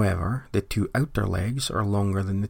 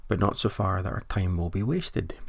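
usual speaking voice

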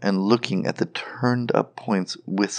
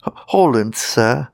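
higher, louder nervous stammer; trembling; 3 semitones higher, 7 dB louder than usual. A narrator's reading. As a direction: answer a goblin in trembling fear. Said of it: "trembling more than ever".

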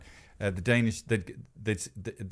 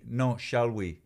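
ordinary talk

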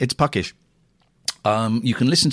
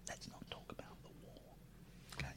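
barely audible, quick whisper; tiny voiceless aside; 32 dB quieter, faster than usual. Acted. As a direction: whisper it hurriedly as a warning. Hushes a forbidden topic.

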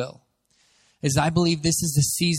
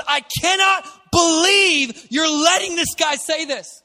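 higher, louder, slow indignant shout; 11 semitones higher, 6 dB louder, slower than usual. Retold or imitated. Preacher acting David indignant at the army, loud indignant shout. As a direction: let righteous outrage rise up. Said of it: "He rises up in a spirit of indignation".

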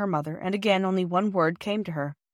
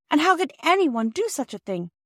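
high-pitched, distressed outcry; pained protest, voice lifted high; indignant cry; 8 semitones higher than usual. A narrator's reading. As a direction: cry out in disbelief as the upset child. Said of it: "cried Bobby".

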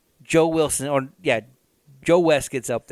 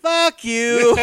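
higher, louder jeer; mock crowd jeer; 7 semitones higher, 4 dB louder than usual. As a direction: boo him off like a crowd. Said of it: "they'd be like".

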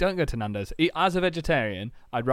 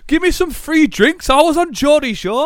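hammed-up reality star at the bar; mock Geordie Shore star; 13 dB louder than usual. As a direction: holler it across the bar. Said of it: "She's at the bar going".

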